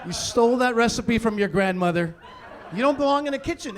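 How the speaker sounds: normal talking voice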